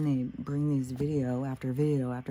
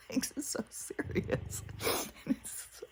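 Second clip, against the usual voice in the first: laughing whisper; 8 semitones higher, 7 dB quieter than usual. Real, not acted. Laughing at the absurdity.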